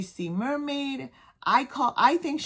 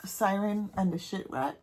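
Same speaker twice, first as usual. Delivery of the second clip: ordinary talk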